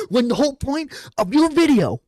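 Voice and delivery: high, loud burst of exasperation; loud incredulous outburst; exasperated shout. Real, not acted. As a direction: hammer it out, voice shooting up. Heated rebuttal to the video maker.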